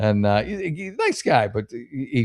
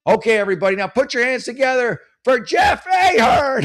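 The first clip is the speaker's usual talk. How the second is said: MC announcing; 14 semitones higher, 6 dB louder than usual. Retold or imitated. MC bringing the comic up after a silent room, big emcee hype. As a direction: boom it out like a club emcee.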